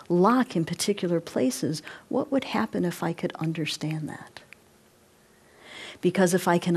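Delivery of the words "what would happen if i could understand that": breathy unvoiced musing; whispered question. Real, not acted. Teacher whispering a question to the students. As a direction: wonder it aloud under the breath.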